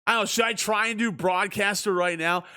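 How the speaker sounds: usual speaking voice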